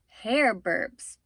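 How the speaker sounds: plain reading voice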